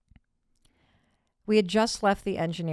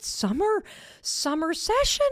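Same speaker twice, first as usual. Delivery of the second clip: excited; 11 semitones higher than usual. Real, not acted. Excited memory of summer session.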